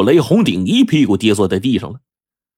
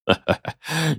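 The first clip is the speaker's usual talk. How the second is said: soft, short robber's chuckle; 6 semitones higher, 7 dB quieter than usual. A narrator's reading. Robber amused by the begging victim, soft chuckle. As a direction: chuckle quietly to yourself. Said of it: "也禁不住哈哈大笑".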